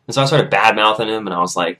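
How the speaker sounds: ordinary talk, nothing marked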